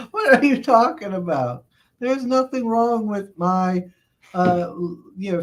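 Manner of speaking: high-pitched, amused protest; mock indignant. Streamer defending his fantasy to teasing viewers, mock indignant. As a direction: protest playfully, pitched well up.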